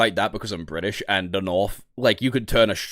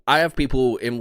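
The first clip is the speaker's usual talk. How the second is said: ordinary talk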